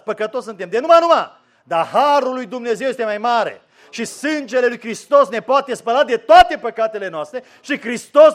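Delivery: loud, high, ringing sermon delivery; full-force preaching. Acted. Preacher thundering hope to a discouraged congregation, full-force preaching. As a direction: preach it out, rising to a roar.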